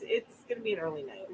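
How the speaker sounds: ordinary talk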